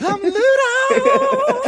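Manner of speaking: slow, high, laughing monster voice; Ludo voice. Pretend. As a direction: drag it out like a goofy monster.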